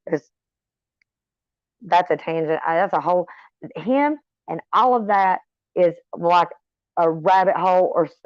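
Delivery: ordinary talk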